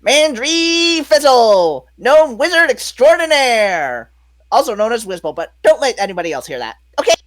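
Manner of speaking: high, loud, showy gnome wizard. Shown as a demonstration. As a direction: announce yourself as a grand little gnome, stretching the name. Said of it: "this is basically my gnome wizard".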